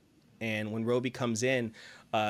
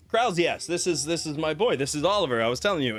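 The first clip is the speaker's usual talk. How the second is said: proud father; 5 semitones higher, 4 dB louder, faster than usual. Acted. Proud father introduces son.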